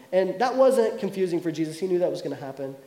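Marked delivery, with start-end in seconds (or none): none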